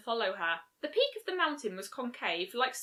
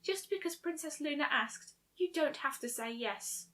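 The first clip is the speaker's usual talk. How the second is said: higher, softer, hesitant plea; uneasy Fluttershy; 4 semitones higher, 6 dB quieter than usual. A narrator's reading. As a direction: nervously urge her friend, tentative and small. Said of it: "looking uneasy".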